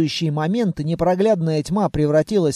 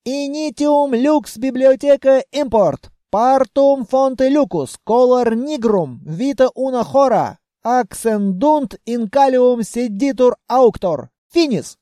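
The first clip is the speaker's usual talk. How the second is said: high, slow chanted Latin spell; spell incantation; 6 semitones higher, 4 dB louder, slower than usual. Acted. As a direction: intone a magic incantation, stretched out. Narrator casts a Latin spell. (торжественно читает заклинание)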